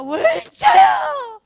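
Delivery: huge drawn-out high scream of a name; screaming in pain. Retold or imitated. Teacher acts out dying father calling eldest daughter, agonized scream. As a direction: cry out at the top of your lungs, stretching the name. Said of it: "막 소리를 고래고래 지르면서".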